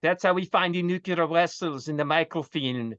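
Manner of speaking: hammed-up film Russian accent. Joining in the spy-film banter, mock Russian accent. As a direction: put on a comic Russian accent. Mimics Star Trek Russian line.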